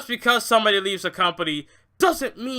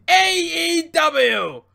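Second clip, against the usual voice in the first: drawn-out, louder mock cry of the answer; mock fan shout; 6 semitones higher, 6 dB louder, much slower than usual. Mimicking wrestling fans, slow loud mock shout. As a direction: ham up the fans' automatic answer, slowly. Mocking fans' knee-jerk answer.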